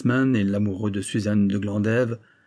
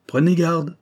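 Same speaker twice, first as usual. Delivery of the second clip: higher, louder menacing warning; threatening, impatient outburst; growled warning; 7 semitones higher, 6 dB louder, slower than usual. A narrator's reading. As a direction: warn him off with sudden force. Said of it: "gronda-t-il".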